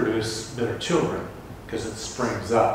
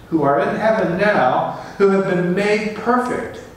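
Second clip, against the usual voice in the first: full-force preaching; 7 semitones higher, 4 dB louder than usual. Acted. Preacher to congregation on coming to Zion, full-force preaching. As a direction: ring it out like a preacher at the peak.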